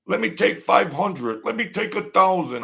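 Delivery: mock the pushy old crook asking for money; pushy ex-con ask. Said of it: "And he's like".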